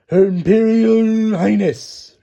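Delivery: high, loud, very slow proclamation; herald announcement. Acted. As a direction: announce the royal title to the whole court.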